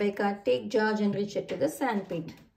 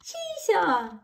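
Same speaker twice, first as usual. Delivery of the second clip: shout; 7 semitones higher than usual. Acted. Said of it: "shouts George".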